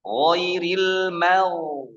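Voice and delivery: slow drawn-out ghairil mau; Quran recitation demo. Shown as a demonstration. Teacher demonstrating recitation slowly to students. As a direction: stretch ghairil mau slowly, lips rounded. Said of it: "Perhatikan di sini".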